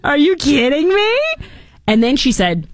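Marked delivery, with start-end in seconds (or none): 0.0-1.2 high, slow stunned disbelief; hammed-up gasp of disbelief; mock surprise. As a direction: act floored by the news. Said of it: "That's what I would say".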